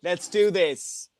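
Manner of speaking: normal talking voice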